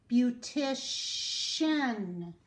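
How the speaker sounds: "Beautician" said very slowly; very slow demo word. Shown as a demonstration. Teacher presenting the word very slowly. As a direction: drag out "Beautician". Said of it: "C I A N again".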